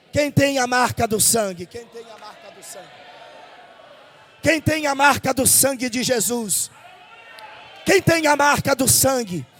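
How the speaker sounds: loud, driving pulpit preaching; fiery preaching. Acted. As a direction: preach it out to the whole church. Preaches at full force to congregation. (pregação inflamada e bem alta)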